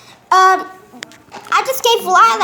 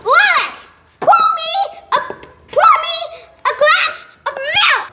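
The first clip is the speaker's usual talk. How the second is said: playful yelling; 11 semitones higher than usual. Acted. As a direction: bellow the order across the room, half laughing. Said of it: "like, yelling at me?".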